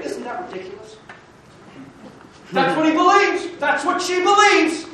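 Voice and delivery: loud, high, impassioned sermon; full-force preaching. Real, not acted.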